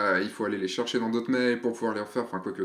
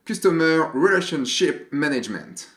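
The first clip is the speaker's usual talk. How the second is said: English accent, slightly higher and louder; 3 semitones higher, 3 dB louder than usual. Shown as a demonstration. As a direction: say it like an English speaker, fully anglicised. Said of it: "Attention, accent anglais". (avec l'accent anglais)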